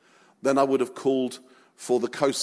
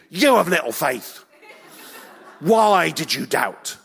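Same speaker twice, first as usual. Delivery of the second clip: scolding Jesus; 3 semitones higher, 4 dB louder than usual. Acted. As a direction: bellow it as an exasperated telling-off. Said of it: "I've read it like that for years".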